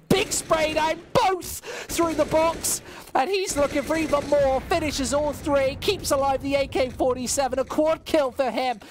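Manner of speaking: high, loud hype over the quad kill; hype call. Real, not acted. Caster calling a quad kill for chat, big hype. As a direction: build it up shot by shot.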